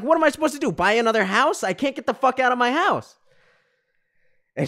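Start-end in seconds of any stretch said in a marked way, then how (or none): 0.0-3.1 high, loud exasperated protest; angry outburst. Retold or imitated. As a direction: plead furiously at an unhelpful agent, retold. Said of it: "getting angrier and angrier".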